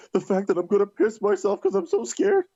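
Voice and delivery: high, scared voice, imitated; earnest panicky confession, acted for laughs; dead serious fright. Rhino cutting off his friend's joke, dead serious and scared. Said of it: "he looks at me dead serious".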